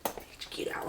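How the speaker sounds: urgent whisper to herself; panicked self-talk whisper. Retold or imitated. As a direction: hiss it under her breath, alone in the room. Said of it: "I'm in there like".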